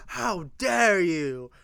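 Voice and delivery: gasp, then drawn-out scandalized protest; hammed-up shocked indignation; mock outrage. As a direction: gasp in pretend horror, stretching it out. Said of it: "before you're like".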